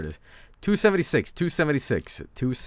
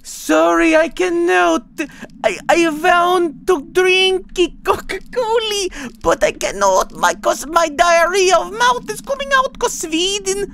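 very high, much louder put-on falsetto, slow; bastard voice; 8 dB louder, slower than usual. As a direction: squeak it out as a mock clueless foreigner. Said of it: "The bastard voice".

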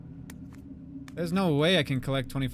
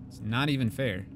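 ordinary talk, nothing marked

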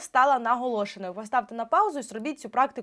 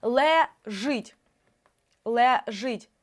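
ЛЕЖИТЬ split as ЛЕ, pause, ЖИТЬ; по складах; much slower than usual. Shown as a demonstration. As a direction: sound out ЛЕ-ЖИТЬ in two syllables. Syllable-by-syllable reading of test word. (ЛЕЖИТЬ повільно по складах)

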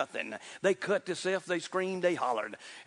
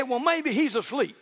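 mocking goad, higher pitched; taunting; 6 semitones higher, 4 dB louder than usual. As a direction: needle them with raised pitch. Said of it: "he started gouging them a little bit".

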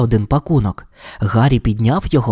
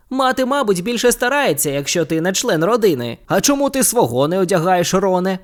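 higher and cheeky; lightly voiced George, teasing; 6 semitones higher than usual. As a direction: twin ribbing his brother.

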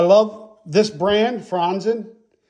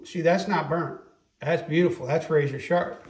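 ordinary delivery

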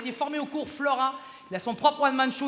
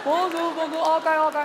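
higher, louder hammed-up Chinese accent waiter; mock Chinese waiter accent; 7 semitones higher, 4 dB louder, slower than usual. Comic imitating a Chinese waiter greeting him, mock Chinese accent. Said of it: "le serveur qui t'attaque".

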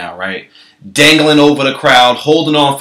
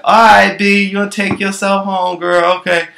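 higher, sweet-talking bartender; 6 semitones higher than usual. Retold or imitated. Bartender stalling the drunk wife, higher. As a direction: keep her there, pitched up. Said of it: "So the bartender was like".

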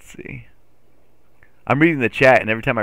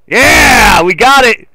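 high, loud victory yell; triumphant shout; 9 semitones higher, 11 dB louder, much slower than usual. Real, not acted. Gamer finally gets the trailer in, a loud high cheer. Succeeds in game.

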